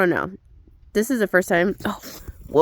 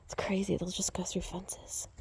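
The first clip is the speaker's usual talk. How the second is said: hushed whispered aside; 16 dB quieter than usual. Real, not acted. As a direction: whisper so as not to startle the goat. Aside while near animals.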